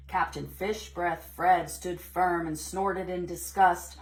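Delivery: usual speaking voice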